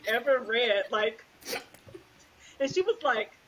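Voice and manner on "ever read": louder, hammed-up retold wail of grief; playing her past self sobbing over a book; mock crying wail. Retelling crying to mom in the car, hammed-up wail. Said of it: "I'm like".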